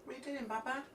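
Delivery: high sing-song pet talk. Real, not acted. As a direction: coo at the dog in a high voice. Baby talk to the dog.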